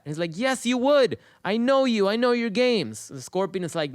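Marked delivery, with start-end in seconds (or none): 0.4-3.1 high, emphatic retort; accusing frog. Acted. Frog arguing with the scorpion's promise, suspicious and accusing. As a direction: throw the accusation back, pitch raised.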